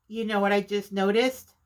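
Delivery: ordinary talk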